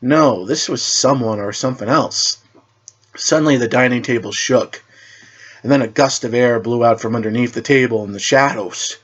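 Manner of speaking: ordinary delivery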